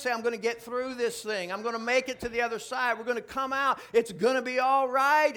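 loud, rising preacher's exhortation; full-force preaching. Real, not acted. Preacher urging his congregation on, loud and rising. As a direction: build it up and preach it hard.

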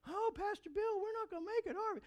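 high, whiny naysayer voice; mocking a gloomy naysayer; mock whiny doubter. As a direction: whine it like a gloomy friend. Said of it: "I didn't need someone to go".

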